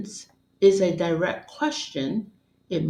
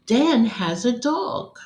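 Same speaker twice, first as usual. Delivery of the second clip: excited reading of an exclamation; 4 semitones higher than usual. Shown as a demonstration. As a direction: say it like thrilling news. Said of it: "should be read with excitement, like this".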